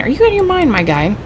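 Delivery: you can't believe what you just heard; high, shocked outburst; incredulous. Acted. Companion rejects leaving the train.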